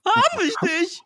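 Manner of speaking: high, triumphant shout. Acted. Louis springing his trap on the ghost, shouting. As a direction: shout it out high, pouncing on the prey. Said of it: "schrie Louis".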